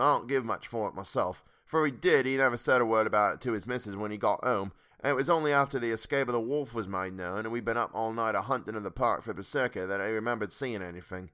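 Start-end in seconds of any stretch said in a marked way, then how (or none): none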